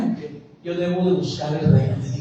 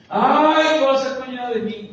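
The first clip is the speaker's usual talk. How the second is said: high-pitched hammed-up worry; imitating someone anxious about tomorrow; mock fretting; 7 semitones higher than usual. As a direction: wail it like an exaggerated worrier. Mimics people fretting about tomorrow.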